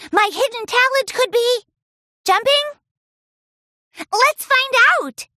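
put-on high, loud kid voice; eager filly. Scootaloo to friends, high eager voice. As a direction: say it keenly.

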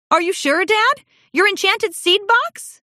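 high, anxious questioning; worried disbelief. A narrator's reading. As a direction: ask in alarm. Daughter worried.

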